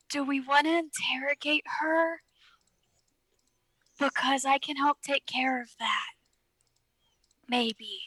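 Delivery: breathy conspiratorial whisper. Acted. Arx whispers to Six, offering to interrogate her, in a whisper. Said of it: "whisper to him".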